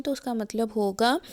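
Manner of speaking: normal talking voice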